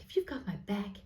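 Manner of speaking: put-on American accent; mock American plea to the universe. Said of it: "doing this in American".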